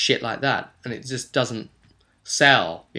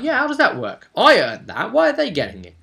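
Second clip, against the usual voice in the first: mock indignant protest; 10 semitones higher, 6 dB louder than usual. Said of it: "the guy could be like".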